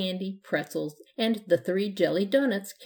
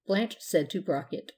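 usual speaking voice

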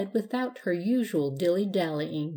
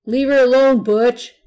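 loud, higher sharp telling-off; scolding shout; 5 semitones higher, 12 dB louder than usual. A narrator's reading. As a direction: yell at a pesky little brother. Big sister scolding little brother.

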